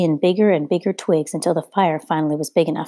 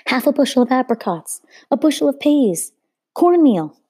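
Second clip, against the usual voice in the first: bidders calling out; 8 semitones higher, 4 dB louder than usual. A narrator's reading. Bidders call out offers.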